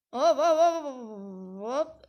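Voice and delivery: loud, stretched-out hops; drawn-out exclamation. Real, not acted. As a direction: draw the hops out loudly as the jump goes. Reacting to a jump in game. (uzata uzata, yüksek sesle)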